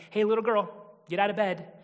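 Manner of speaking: just bark a mild order to get up; a careless adult rousing a child, mildly brisk; brusque wake-up call. Retold or imitated. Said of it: "It's not like".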